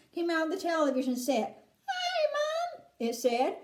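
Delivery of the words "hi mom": very high mouse-like squeak; tiny little voice. A narrator's reading. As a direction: squeak it like a miniature boy. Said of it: "A tiny little voice".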